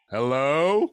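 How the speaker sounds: loud nervous call. Retold or imitated. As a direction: call out into the house. Calling into empty house.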